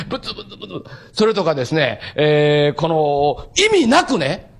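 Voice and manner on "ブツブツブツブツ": ブツブツブツブツ mumbled softly through a chuckle; laughing mutter. Shown as a demonstration. Preacher joking that some listeners mutter too, soft laughing mumble. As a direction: tease the audience with a giggly mumble. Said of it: "ブツブツブツブツ言ってる方".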